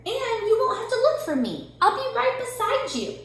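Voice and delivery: high cheerful bird voice; small bird speaking high. A narrator's reading. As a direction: reassure Rabbit, high and bright. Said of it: "said Bird".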